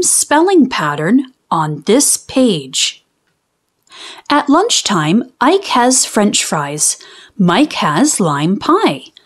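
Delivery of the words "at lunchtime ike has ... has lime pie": plain reading voice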